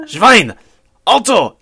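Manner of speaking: barked German commands, rising to a loud shout; mock angry German. Podcaster mimicking how German people sound angry, a mock shout. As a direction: bellow it like a furious drill sergeant. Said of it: "they'll have, like, the whole".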